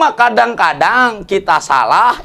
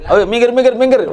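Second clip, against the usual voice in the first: bossy call to move aside, pitched higher; arrogant driver; 5 semitones higher, faster than usual. Acted. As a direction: shoo people out of the way like a show-off. Arrogant new car owner clears way.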